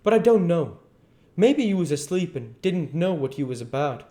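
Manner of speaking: high, exaggerated mock doubt; very sarcastic. A narrator's reading. King needling the duke, heavy sarcasm. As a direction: feign ignorance with a sneer. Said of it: "very sarcastic".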